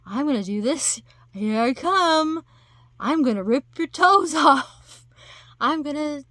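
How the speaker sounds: high, loud, gleeful attacker; gleeful monster. Retold or imitated. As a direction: play a monster gleefully coming after someone. Mimics gleeful smiling attackers charging.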